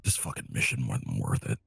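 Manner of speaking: very low, quiet muttering; mumbled grumble. Acted. Player's character gives up on calling him back, low mumble. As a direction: mumble it low under your breath. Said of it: "I mumble".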